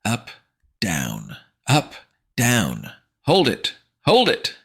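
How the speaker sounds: barked high counting; drill sergeant. Acted. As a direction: call the reps like a drill instructor. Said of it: "sounding more like a drill sergeant".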